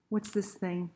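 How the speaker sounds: usual speaking voice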